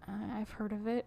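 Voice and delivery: hushed, timid little mumble; quiet timid quote. Retold or imitated. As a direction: say it softly, like a shy man caught off guard. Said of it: "so quietly".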